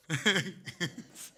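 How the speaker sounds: amused chuckling; real chuckle. Real, not acted. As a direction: laugh at your own joke. Laughs after his own joke.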